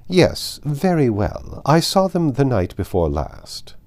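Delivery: clipped condescension, final words slowed; haughty grand lady. Acted. Lady Catherine answers curtly.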